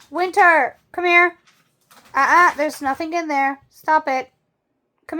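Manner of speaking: loud, high call to the dog; sharp loud call across the room. Real, not acted. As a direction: call him over firmly. Calling barking dog over.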